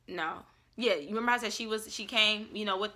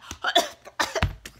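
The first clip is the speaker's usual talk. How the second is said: breathy cough as if the shot burned; mock choking cough; 5 dB louder than usual. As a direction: imitate a harsh choking cough. Chokes after taking the shot.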